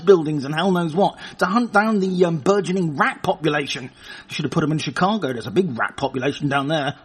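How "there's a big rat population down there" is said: normal talking voice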